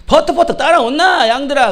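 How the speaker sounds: high, loud Gyeongsang dialect shout to sheep. Acted. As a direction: imitate a shepherd in a punchy Gyeongsang accent. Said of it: "경상도". (크고 높은 경상도 사투리로 양 부르기)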